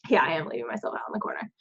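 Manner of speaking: a quick, whispered joke to herself; tossed off under the breath, fast; whispered aside. Real, not acted. Joking self-deprecating aside.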